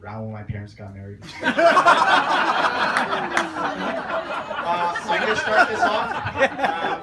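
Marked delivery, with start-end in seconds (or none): 1.2-4.6 high loud burst of laughter; cracking up before the speech. Real, not acted. Opening laugh at wedding speech. 4.7-7.0 talking through high laughter; barely holding in a laugh. Real, not acted.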